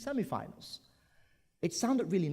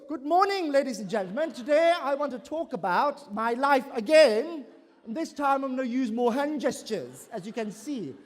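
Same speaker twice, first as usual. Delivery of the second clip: higher, louder overeager greeting; eager speaker; 9 semitones higher, 7 dB louder than usual. Retold or imitated. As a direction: greet the crowd in a pumped-up way. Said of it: "next time the person comes up on stage".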